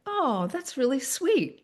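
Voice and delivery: tender response. Retold or imitated. Answering the repairman's memory of his wife, slow and tender. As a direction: let it melt gently.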